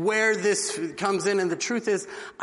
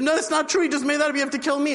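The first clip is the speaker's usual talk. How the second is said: frantic protest; 8 semitones higher, faster than usual. Retold or imitated. Condemned friend protesting to the king, lightly voiced frantic plea. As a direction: insist urgently, jumping in. Friends arguing who dies.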